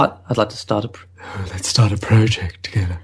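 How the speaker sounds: low, put-on silly voice; a lightly put-on low voice. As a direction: play a mock seductive partner. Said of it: "We can talk in this voice all the time".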